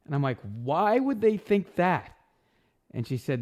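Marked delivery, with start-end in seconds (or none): 0.4-2.2 much higher, slow and louder; panicked question to his roommate; alarmed disbelief. Retold or imitated. On the phone with his roommate, high slow alarmed disbelief. Hearing the whole city thinks so.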